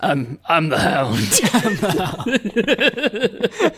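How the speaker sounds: slow character voice breaking into laughter; the Hound. Shown as a demonstration. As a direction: attempt a tough-guy impression and crack up. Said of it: "I could try the Hound".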